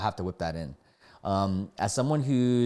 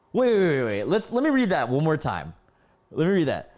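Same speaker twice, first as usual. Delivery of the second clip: high, loud, flustered interruption; astonished; 6 semitones higher, 4 dB louder than usual. Real, not acted. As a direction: halt everything in disbelief, pitched up. Stunned, stops to reread.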